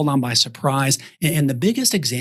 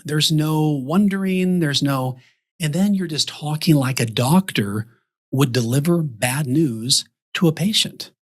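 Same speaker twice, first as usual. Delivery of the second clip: ordinary talk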